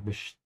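"Best" said as "besht", s turned to sh; sh sound. Shown as a demonstration. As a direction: say the st in "Best" as sht. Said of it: "pronounce the sh here".

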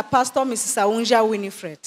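normal talking voice

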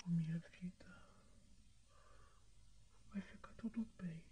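soft tender whisper; calm her gently under your breath; reassuring whisper. Acted. (sussurro tranquilizador)

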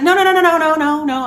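high, loud rush of no's that settles down; urgent no no no. Retold or imitated. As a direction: jump in to stop him, then ease off. Quickly calming husband's defensiveness.

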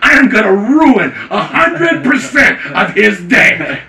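loud, high re-enacted tirade; worked-up mock menace; defiant threat. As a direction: warn them loudly, hammering each phrase. Retold loud threat at security call.

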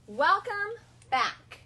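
loud, slow, spaced model read; teacher model reading. Shown as a demonstration. Teacher reading the morning message aloud, loud and slow. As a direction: model each word for the child to echo. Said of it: "Your turn".

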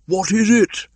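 a bear calling up to the kite; Baloo calling up. Acted. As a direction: shout up to the bird in the sky.

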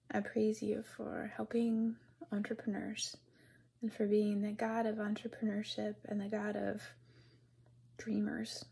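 soft, hushed, earnest prayer; a whispered prayer for entrepreneurs. Real, not acted. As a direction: pray quietly and intimately to God. Prays aloud to God.

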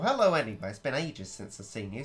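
ordinary talk

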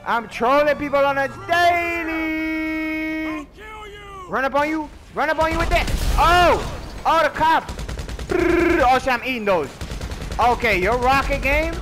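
high, loud, hyped trash talk; hyped high taunts. Real, not acted. Trolling other players mid-fight, high loud hype. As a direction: taunt them sky high.

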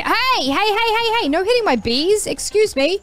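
high, sharp protest of hey; indignant yelling. Real, not acted. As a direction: scold the cows for hitting your bees. Bees attacked, scolding enemies.

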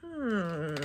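usual speaking voice

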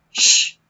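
drawn-out hissed sh; sh sound. Shown as a demonstration. Teacher demonstrating the sh sound, loud hissed sh. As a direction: hiss a long sh. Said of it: "It sounds like sh".

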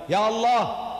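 "Ya Allah" called out high as an invocation; opening exclamation. Shown as a demonstration. Showing how Arabic begins, "Ya Allah" exclaimed. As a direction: hit "Ya Allah" as a vocative cry. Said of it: "We begin with an exclamation".

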